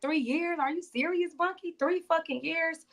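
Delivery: high, hushed mock disbelief, slowing down; incredulous. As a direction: ask it like you can't believe your ears, under your breath. Retold disbelief to bunkmate.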